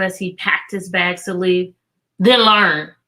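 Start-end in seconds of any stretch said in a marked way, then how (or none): 2.2-2.9 curt. Acted. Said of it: "he said shortly".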